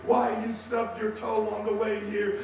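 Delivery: ordinary talk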